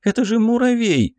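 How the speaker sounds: put-on high scornful girl voice; mock the tiny threat, high-pitched; young woman voice. Белка scoffs at the kill.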